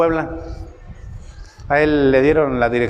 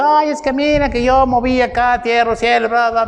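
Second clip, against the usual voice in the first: raised, higher mock-annoyed complaint; annoyed friend; 8 semitones higher than usual. Imitating an angry friend complaining about the job offer, pitched up. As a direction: act out the friend's irritated protest.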